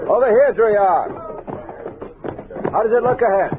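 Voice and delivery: hailing a scout from a distance; calling out. Acted. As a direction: raise the voice to reach a rider up ahead.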